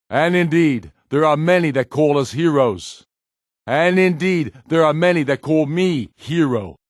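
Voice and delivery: high, loud oration to a crowd; rousing public speech. Acted. Ulfric rallies his people in the square, booming oration. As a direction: proclaim it to the gathered people.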